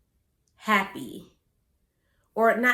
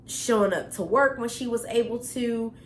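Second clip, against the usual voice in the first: normal talking voice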